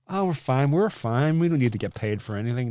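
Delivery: lightly mock a rich complacent type; mock carefree. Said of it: "No, no, that's not what I'm saying".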